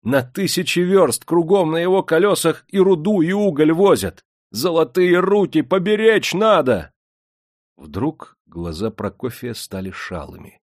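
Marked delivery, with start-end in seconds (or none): 0.0-6.9 high, loud, impassioned plea; heated, ringing appeal; fervent. A narrator's reading. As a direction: argue hotly to save the craftsman. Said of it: "сказал с жаром".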